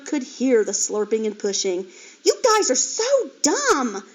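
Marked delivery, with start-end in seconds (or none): 2.2-4.0 very high, loud, slow holler; angry piglet hollering. A narrator's reading. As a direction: holler it at her brothers. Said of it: "she hollered".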